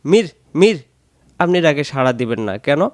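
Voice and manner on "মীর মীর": calling out. Retold or imitated. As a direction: shout across the room for Mir. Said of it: "কেউ বলতে চায়".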